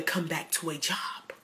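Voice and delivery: hushed reassuring aside; whispered aside. Acted. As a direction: drop to a whisper.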